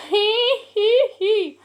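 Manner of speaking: a high, squeaky hee hee giggle. Real, not acted. Vlogger giggling at her own joke, high squeaky giggle. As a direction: giggle it out, pleased with yourself.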